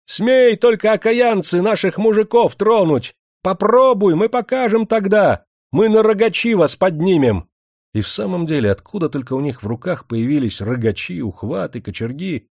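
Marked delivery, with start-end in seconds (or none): 0.1-7.5 high, loud cries of outraged village women; indignant high-pitched hollering; angry wailing yell. A narrator's reading. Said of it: "не утерпели и завопили".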